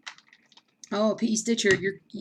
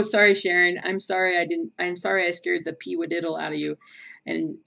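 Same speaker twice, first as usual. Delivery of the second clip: ordinary talk, nothing marked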